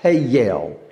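Hey, yell in two drawn beats; Hey, yell stretched with a falling tune; Southern drawled hell. Shown as a demonstration. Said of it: "Two syllables".

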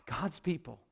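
very soft, low aside; hushed disbelief. Real, not acted. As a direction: say it low, barely voiced. Preacher dwells on the betrayal.

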